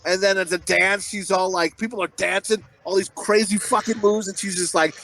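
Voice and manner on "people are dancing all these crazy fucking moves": ordinary talk, nothing marked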